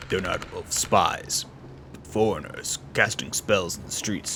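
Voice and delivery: measured words in a deep voice; deep-voiced game character.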